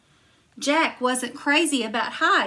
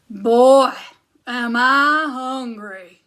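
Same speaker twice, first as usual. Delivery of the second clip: loud, slow booming chant; big giant stomping in for lunch; 7 dB louder, slower than usual. Acted. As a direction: boom each syllable like a giant.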